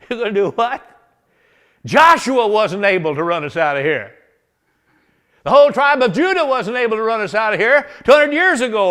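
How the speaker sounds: loud high mocking disbelief; scoffing taunt. Jebusites on the wall jeering at young David. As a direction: jeer down at the kid.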